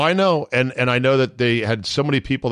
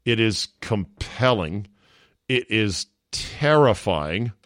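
slow dramatic; slower than usual. Real, not acted. Slow dramatic verdict on show.

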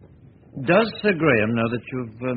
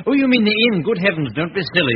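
hearty insistence; 7 semitones higher, 6 dB louder than usual. Acted. Insists guest stays with him.

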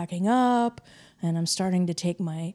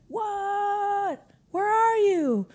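husband calling back; 13 semitones higher, much slower than usual. Retold or imitated. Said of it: "And he's like".

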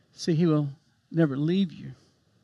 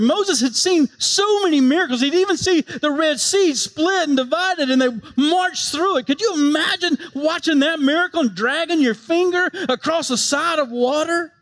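higher, marveling preaching; excited; 8 semitones higher than usual. Real, not acted. Preacher marveling at the Red Sea miracle, excited. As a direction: paint the miracle with wonder, higher.

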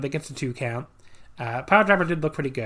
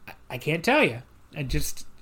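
ordinary talk, nothing marked

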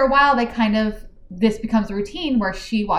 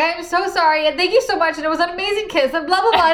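higher, rushed apologetic gushing; mock flustered word vomit, voice pitched up; rambling gush; 8 semitones higher than usual. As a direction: pour the words out in a flustered ramble. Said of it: "she starts word vomiting again".

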